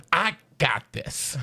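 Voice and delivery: very slow, badly faked comedian impression; Cat Williams voice. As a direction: stretch it out in a shaky attempt at mimicry. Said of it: "I can't do a good cat impression".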